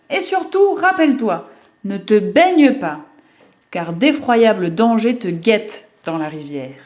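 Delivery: a mother finally letting go, warning hard. Acted.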